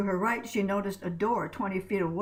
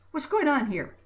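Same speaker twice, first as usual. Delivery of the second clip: much higher, sharp demand; 7 semitones higher, 3 dB louder than usual. A narrator's reading. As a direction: demand an answer from all three. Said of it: "she demanded".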